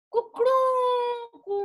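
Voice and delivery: high, drawn-out cock-a-doodle-doo; rooster crow. Shown as a demonstration. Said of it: "कैसी आवाज होती है?".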